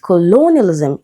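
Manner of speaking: "colonialism" with a schwa first and LO drawn out; second-syllable stress. Shown as a demonstration. Teacher showing the vowel change, second-syllable stress. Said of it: "it becomes a".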